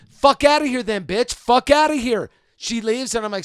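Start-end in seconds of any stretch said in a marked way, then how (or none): 0.1-2.3 loud, high parting yell; retold yelling. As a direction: shout after her as she leaves. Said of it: "and I'm just like".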